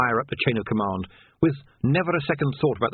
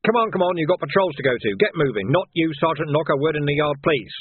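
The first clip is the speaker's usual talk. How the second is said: raised, higher schoolteacher's order; brisk command; 6 semitones higher, 4 dB louder than usual. Acted. Sergeant sending squad out on patrol, brisk command. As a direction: chivvy the squad along, loud and urgent.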